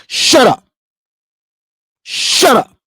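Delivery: loud scornful shut up; loud dismissive snap; angry retort. Real, not acted. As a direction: shut them down hard. Snapping back at mocked voice.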